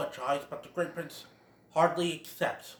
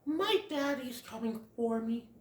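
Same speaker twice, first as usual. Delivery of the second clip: higher, much softer small voice; polite little Bambi; 9 semitones higher, 8 dB quieter than usual. Retold or imitated. Bambi declining Feline's offer, small voice. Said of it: "But Bambi says".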